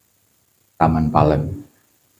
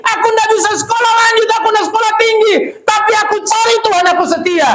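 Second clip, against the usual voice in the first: high, loud shouted preaching climax; preaching at full force; 4 dB louder, faster than usual. Acted.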